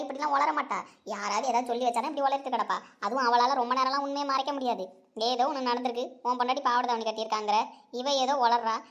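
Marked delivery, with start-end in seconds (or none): none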